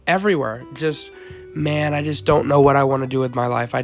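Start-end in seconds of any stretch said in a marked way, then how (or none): none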